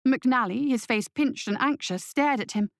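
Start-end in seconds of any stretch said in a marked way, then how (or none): none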